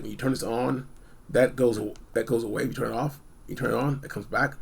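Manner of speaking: ordinary talk, nothing marked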